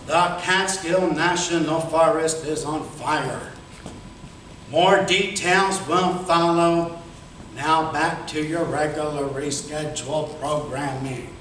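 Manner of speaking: low, slow sportscaster impression; Howard Cosell. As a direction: announce it like a slow broadcaster. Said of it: "this is Howard Cosell".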